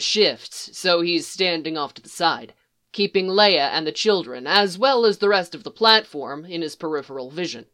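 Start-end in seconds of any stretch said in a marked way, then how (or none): none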